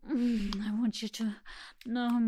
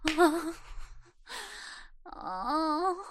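moan; 6 semitones higher than usual. Acted. Moans during roleplay.

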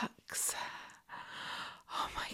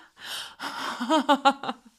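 loud breathy laugh trailing into a sigh; long breathy giggle; teasing laugh; 5 semitones higher, 9 dB louder than usual. Pretend. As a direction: laugh out playfully, full of breath. Playful laugh during role-play.

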